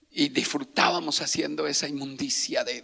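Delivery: ordinary delivery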